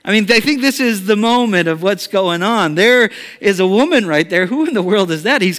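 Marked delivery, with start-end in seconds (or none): none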